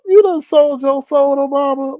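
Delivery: high disbelieving question; shocked accusation; incredulous. Pretend. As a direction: ask it like you can't believe it.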